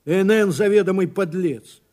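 a fool's emphatic condemnation; denouncing exclamation. A narrator's reading. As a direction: denounce him forcefully. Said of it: "воскликнул дурак".

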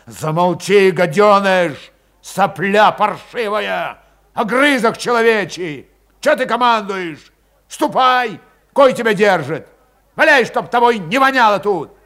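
loud, rising enraged abuse; barely holding back a blow; furious. A narrator's reading. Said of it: "взбесил его окончательно".

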